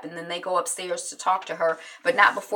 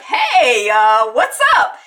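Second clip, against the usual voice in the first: loud, drawn-out mock hello; imitating a man overdoing casual; overeager greeting; 4 semitones higher, 11 dB louder, much slower than usual. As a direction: belt out a too-cheery phone greeting. Forced-casual phone greeting, acted.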